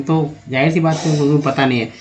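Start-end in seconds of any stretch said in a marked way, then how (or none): none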